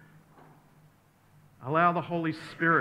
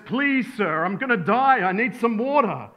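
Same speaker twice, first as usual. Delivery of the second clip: high, loud, hammed-up begging; desperate pleading; 7 semitones higher, 7 dB louder than usual. Dying traveller begging a third man for water, desperately pleading. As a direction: beg as if it's the last chance.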